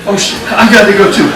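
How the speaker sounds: fast, high panicky blurt; panicked rush. Retold or imitated. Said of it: "he's like".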